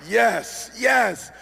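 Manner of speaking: rising excited yes; excited cheer. Retold or imitated. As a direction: cheer with delight. Excited dad.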